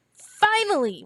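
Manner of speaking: put-on girl elated at finally getting work. A narrator's reading. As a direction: burst out with relief and joy. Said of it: "was her elated response".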